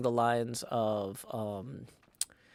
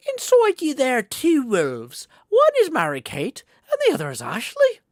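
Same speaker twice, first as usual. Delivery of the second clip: high, loud, exaggerated swoopy delivery; hammed-up reading; 9 semitones higher, 9 dB louder than usual. Reading a viewer's two-wolves joke aloud, hammed-up. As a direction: read it out big and theatrical, pitched high.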